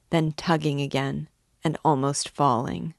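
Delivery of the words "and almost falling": usual speaking voice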